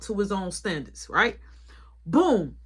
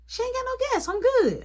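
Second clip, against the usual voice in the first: higher voice for coworker; imitating coworker; 13 semitones higher than usual. Boss imitates coworker refusing to help, in a higher voice. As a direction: voice the coworker a good deal higher. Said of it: "She like".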